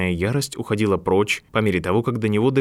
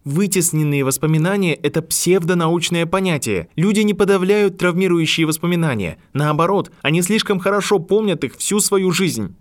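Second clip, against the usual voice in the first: rising anger; 9 semitones higher, 3 dB louder than usual. A narrator's reading. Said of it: "Гарри вновь ощутил поднимающийся гнев".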